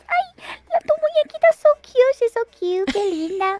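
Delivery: high-pitched doting coo; gushing mother. Retold or imitated. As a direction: imitate a mother cooing over a little doll. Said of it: "she thinks it's so cute".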